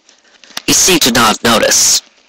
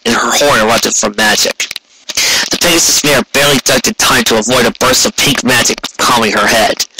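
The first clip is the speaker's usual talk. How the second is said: ordinary delivery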